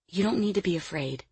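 soft, soothing woman; gentle reassurance. Acted. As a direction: coax a frightened child softly. Woman coaxes frightened child out.

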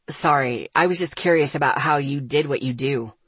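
low-pitched, curious male figure; Asher, apologetic young man's role. Acted.

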